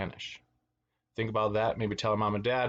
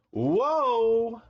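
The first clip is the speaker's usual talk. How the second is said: high, loud, long-held whoa; drawn-out mock alarm; startled whoa; 12 semitones higher, 6 dB louder than usual. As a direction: cry out as if losing your balance. Puppy tiptoeing goes wrong in story.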